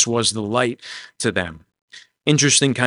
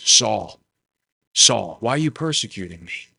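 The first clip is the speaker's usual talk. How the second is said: calm, plain appeal. Acted. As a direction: say it simply and calmly, no shouting. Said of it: "imagine he just says it calmly".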